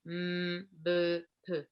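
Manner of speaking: "M, B, P" as bare lip sounds, not letter names; "M" hummed, "B" and "P" released briefly from closed lips; isolated bilabial sounds. Shown as a demonstration. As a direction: press the lips together for each of "M, B, P". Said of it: "Simplemente es el labio que se une".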